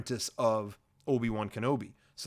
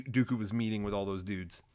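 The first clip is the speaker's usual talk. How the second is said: ordinary delivery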